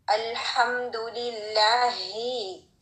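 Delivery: अल्हम्दुलिल्लाही slow with a strong airy ह. Shown as a demonstration. Teacher recites slowly, stressing the ह. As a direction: stretch the ह in अल्हम्दुलिल्लाही. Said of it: "make it really strong".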